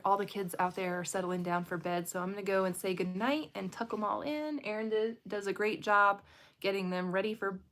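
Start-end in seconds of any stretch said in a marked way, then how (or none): none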